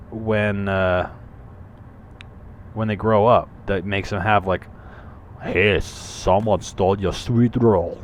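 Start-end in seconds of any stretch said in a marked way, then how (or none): 5.4-8.0 put-on slow, thick Austrian-style accent; Arnold Schwarzenegger. Grown-up Skyrim guard teasing the player, mock Schwarzenegger accent. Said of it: "suddenly turn into Arnold Schwarzenegger".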